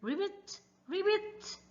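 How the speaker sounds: soft high frog call; frog ribbit. Acted. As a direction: croak like a little frog. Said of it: "Frogs says".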